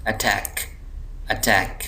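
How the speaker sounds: Attack with टैक pressed; light अ, firm टैक in attack; stress on TACK. Shown as a demonstration. Teacher showing which syllable gets weight, stress on टैक in attack. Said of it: "इस पर ज़ोर दिया जा रहा है".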